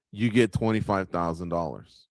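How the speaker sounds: ordinary delivery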